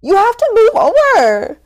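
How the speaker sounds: loud, high, drawn-out indignant outburst; exasperated retort. Retold or imitated. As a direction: act out scolding the sidewalk hogs, loud and slow. Acted indignant retort to sidewalk hogs.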